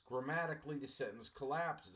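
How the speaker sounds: normal talking voice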